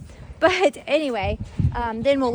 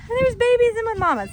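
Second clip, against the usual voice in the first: high, louder startled exclamation; alarmed surprise; 12 semitones higher, 5 dB louder than usual. Real, not acted. Mom spotting baby goats in the mamas' pen, startled. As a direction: catch the problem with a jolt. Discovers goats got through.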